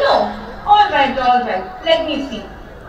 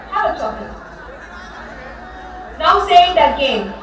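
ordinary talk

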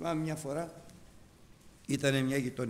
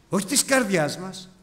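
loud, high, repeated denials; fervent preaching; 8 semitones higher, 10 dB louder than usual. Acted. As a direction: hammer each "όχι" to the congregation. Preaching at full force. (δυνατό κήρυγμα, τονισμένο «όχι»)

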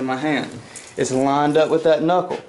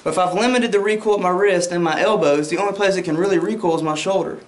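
ordinary delivery

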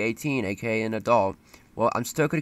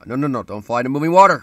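panicked shout; 4 semitones higher, 11 dB louder, faster than usual. Real, not acted. Panics at moving water.

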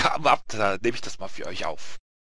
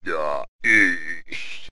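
deep, drawn-out Death voice; sepulchral, stretched-out answer; voice from the crypt; 7 semitones lower than usual. Acted. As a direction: intone it low as from the grave. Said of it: "dann wieder die Gruft, die Stimme so".

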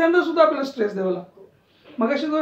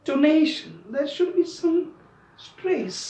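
normal talking voice

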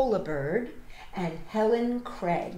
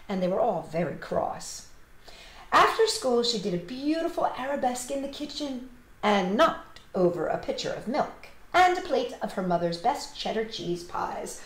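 ordinary delivery, nothing marked

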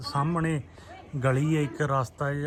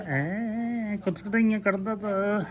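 playful tickle voice; 6 semitones higher, much slower than usual. Real, not acted. Showing the child tickling.

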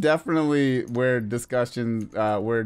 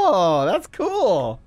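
laughing amazement; 6 semitones higher, 4 dB louder, much slower than usual. Real, not acted.

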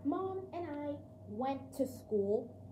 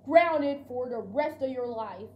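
stern punishment; 8 dB louder than usual. Acted. Dad punishes the dog.

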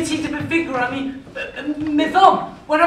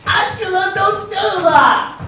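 whiny plea; 6 semitones higher than usual. Acted. Boy pleading.

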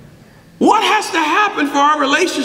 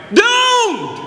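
Doomed repeated higher and shouted; echoed shout; 8 semitones higher than usual. Acted. Preacher hammering the congregation, higher shouted repeat. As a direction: ring out Doomed as a final verdict.

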